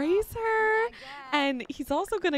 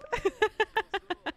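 long, high giggle; amused giggle; 4 semitones higher than usual. Real, not acted. Player amused by her Sims' thanks, high giggle. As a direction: giggle after the line. Laughs after voicing the Sim.

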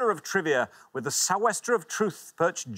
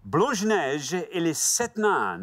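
slow, relished French title read; deliberate French pronunciation; French accent; 3 semitones higher, slower than usual. Acted. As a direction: roll it out in showy French. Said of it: "a French accent, though, don't you".

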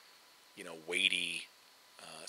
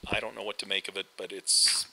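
ordinary talk, nothing marked

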